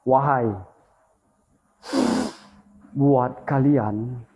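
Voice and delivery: slow, ceremonial call to the spirits; solemn invocation. Real, not acted. Ghost hunter invoking the unseen, slow solemn ritual call. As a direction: draw the words out like a ritual.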